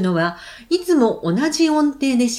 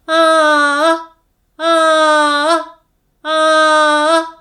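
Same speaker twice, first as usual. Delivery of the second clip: high ah; 4 semitones higher, 5 dB louder than usual. Shown as a demonstration. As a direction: lift the pitch and hold each ah long. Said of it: "今度は高い声で".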